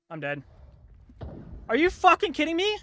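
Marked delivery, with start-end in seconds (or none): 1.7-2.8 very high, loud scream of disbelief; outraged yell. Real, not acted. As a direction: yell it in real, furious disbelief. Game kicked player out mid-fight.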